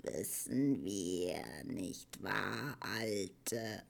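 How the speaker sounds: very slow, low, soft creature voice; wheezing old Morla. A narrator's reading. Old Morla answers Atreyu by talking to herself, wheezing. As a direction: puff the words out low and weary. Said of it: "schnaufte die Moala".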